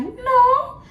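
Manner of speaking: very high squawked refusals; mock her in a piercing high voice; exaggerated imitation of a stubborn worker; mimicking coworker. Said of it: "that's dead ass how she used to talk".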